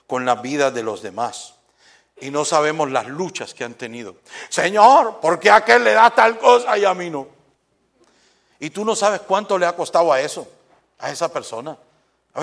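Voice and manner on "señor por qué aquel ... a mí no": higher, louder whiny plea of "Señor, ¿por qué?"; envious complaint. Retold or imitated. Believer complaining to God about others' blessings, whiny and loud. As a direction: whine at God like a jealous believer.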